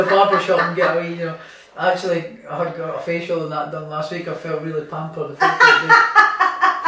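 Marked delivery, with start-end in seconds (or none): 5.3-6.8 high, loud laughing words; can't stop laughing through it. Real, not acted. Boxer cracking up about his first facial.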